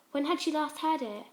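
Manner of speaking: plain reading voice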